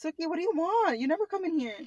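high, soft pet-talk. Real, not acted. Owner asking her cat at the door, soft pet-talk.

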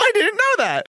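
high, surprised exclamation. Real, not acted. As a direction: blurt it out in shock. Loud surprised repeat.

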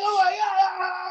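high, loud mock gasping; imitating a thrilled, winded rider; breathless rider. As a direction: gasp it out like a rider just off the coaster. Rider gasping after the ride.

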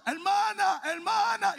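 high loud desperate calling; frantic pleading. Retold or imitated. Pastor with a mouth of dog hair begging the sister, high loud plea. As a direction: beg her to stop and listen.

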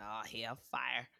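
low, slow, soft groan of dread; low slow mock-dread mutter. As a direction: drag it out low like doom is coming. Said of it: "I was like".

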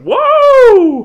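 very high, loud, drawn-out whoa; exaggerated shocked whoa. [name] reacts to Connor's outburst at the family, exaggerated alarm. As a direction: let it soar up in mock alarm.

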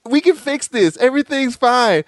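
high, lightly put-on sweet reassurance; Brandy voice. Brandy assuring Darnell after burning breakfast, high and sweet. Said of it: "she was like".

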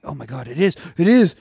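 high, fast discovery; excited. Retold or imitated. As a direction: blurt it out in excitement. Retold character discovering water.

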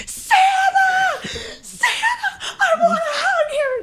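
high, squealing little cartoon girl laughing; excited screaming. Friend imagines cartoon Maria seeing Santa, squealing. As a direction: squeal it like a thrilled cartoon kid. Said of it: "Just, like, screaming".

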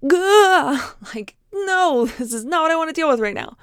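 high, loud cry of overwhelmed dread, easing into protest; mock panic. Anxious person balking at a crowded room, mock panic. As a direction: blurt a hammed-up recoil, then refuse.

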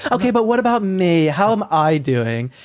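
mock diva fishing for attention; self-centred influencer. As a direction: butt in like an attention-hungry influencer. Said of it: "And she's like".

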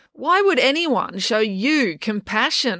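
high, drawn-out disbelief; incredulous scorn. A narrator's reading. April scoffing at Loretta's compassionate day off, incredulous scorn. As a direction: stretch the question out in scornful amazement.

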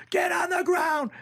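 high, drawn-out shout; yelled command, stretched out; shouted police order. Retold or imitated. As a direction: bellow it like a raid on a house. Acting out police arrest.

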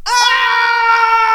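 long, high, very loud scream; agonised scream. Acted. Blinded giant waking with a stake in his eye, screaming. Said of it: "and he screamed".

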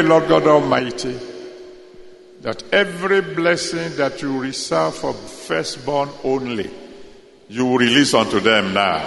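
fervent, rising intercession; prayer. Acted. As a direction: plead boldly over the congregation. Prayer for firstborn family.

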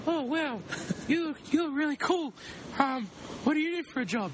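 put-on high girly voice; girl voice. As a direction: play a girl chatting up a guy. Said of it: "I'm a girl".

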